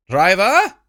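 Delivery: loud high call. Acted. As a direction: call out to the driver. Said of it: "she called".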